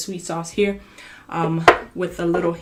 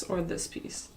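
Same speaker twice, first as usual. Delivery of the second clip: whispered; 9 dB quieter than usual. Real, not acted. Offering pieces to viewers.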